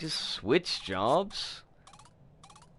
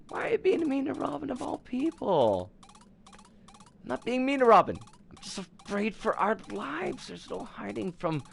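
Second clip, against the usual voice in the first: much higher, defensive wife's voice; a wife pushing back at her husband, voiced high; high pleading wife voice; 7 semitones higher than usual. Wife scolding her husband about Robin, high and defensive. In-character marital argument.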